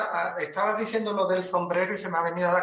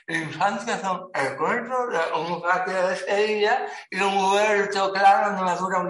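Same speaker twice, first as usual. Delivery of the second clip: as if shaving; 4 dB louder than usual. Shown as a demonstration. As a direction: say it like you're mid-shave, face taut. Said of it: "Pronuncia eso como si te estuvieras afeitando". (como si te estuvieras afeitando, lento y con la boca tensa)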